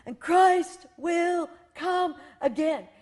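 slow soaring booming declaration. Retold or imitated. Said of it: "the accent was definitely on the last line".

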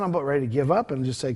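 normal talking voice

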